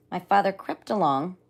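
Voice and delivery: ordinary delivery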